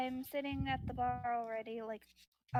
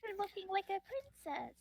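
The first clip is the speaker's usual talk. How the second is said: cooing character voice; 6 semitones higher than usual. Acted. Gnome repeats compliment in character.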